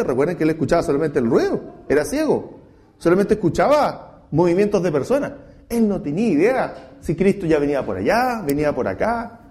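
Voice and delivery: usual speaking voice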